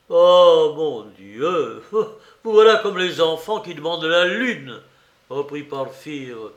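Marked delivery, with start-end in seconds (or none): 0.1-4.8 loud drawn "Oh", then amused scoff; jeering exasperation with a chuckle; mocking. A narrator's reading. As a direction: laugh it off teasingly. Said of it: "toujours goguenard".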